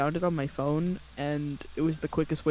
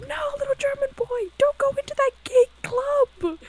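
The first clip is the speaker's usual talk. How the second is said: very high, loud German squeal; squeaky German voice; 11 dB louder than usual. Acted. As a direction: plead loudly as a squeaky German cartoon character. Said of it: "my favorite one is like".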